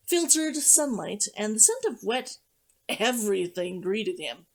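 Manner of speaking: plain reading voice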